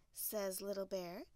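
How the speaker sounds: ordinary delivery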